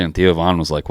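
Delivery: usual speaking voice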